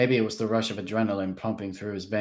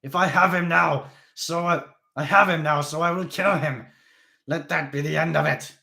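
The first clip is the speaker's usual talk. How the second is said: loud, raised voice; defiant; 8 semitones higher, 7 dB louder than usual. Acted. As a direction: insist on the kill. Krebsley defiant.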